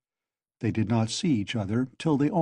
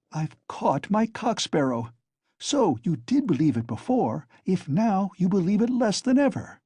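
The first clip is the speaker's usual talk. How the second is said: gloating; 7 semitones higher than usual. Acted. Triumphant taunting catch.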